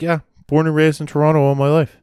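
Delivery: a light retold Canadian brogue. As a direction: imitate the Toronto guy's Canadian brogue. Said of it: "he had almost a brogue".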